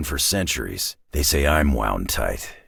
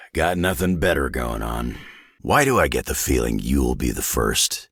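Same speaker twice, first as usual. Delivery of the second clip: usual speaking voice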